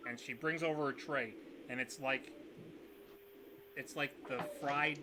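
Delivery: normal talking voice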